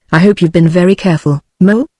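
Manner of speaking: usual speaking voice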